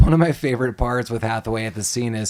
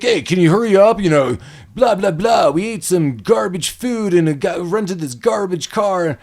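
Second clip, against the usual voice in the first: higher, impatient, dismissive whine; arrogant; 7 semitones higher than usual. Retold or imitated. Chris snapping at the gate guard, arrogant and impatient. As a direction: brush off the guard like you own the place. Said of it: "Chris is just arrogant right off the bat".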